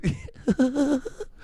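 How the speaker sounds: high little stifled whimpers; mock pitiful squeaky whimper; pathetic whimper. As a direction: imitate a baby mouse crying. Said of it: "it's just a whimper".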